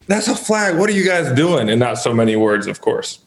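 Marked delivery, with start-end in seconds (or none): 0.1-1.7 half-voiced yell, pitched up; retold yelling at ref. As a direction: imitate an indignant complaint to the ref in a raised pitch. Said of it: "like, yelling at the ref".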